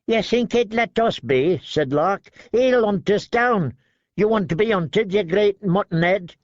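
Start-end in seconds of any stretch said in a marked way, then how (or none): 0.1-1.6 higher, nervous scornful voice; thin sharp man mocking; Lark sneering. A narrator's reading. 2.5-6.3 higher, nervous scornful voice; thin sharp man mocking; Lark sneering. A narrator's reading. Lark taunts.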